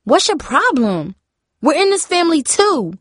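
high-pitched, hurt outrage; indignant yell. A narrator's reading. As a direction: protest to a mother with wounded anger. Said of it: "Jamie yelled".

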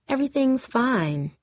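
high, falsely sweet assurance; dripping with honey. A narrator's reading. Vanessa covering her anger before the friends, honeyed voice. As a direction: lay on the fake sweetness. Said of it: "Vanessa's voice dripped with honey".

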